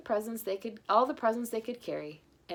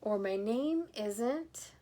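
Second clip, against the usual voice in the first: trailing; 3 semitones higher, much slower than usual. Acted. Grandpa forgetting his name.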